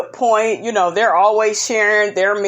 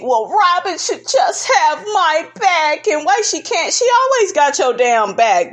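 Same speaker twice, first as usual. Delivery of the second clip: mimicking Giselle; 7 semitones higher than usual. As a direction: whine it like you're in your feelings, pitched up. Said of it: "in her feelings saying".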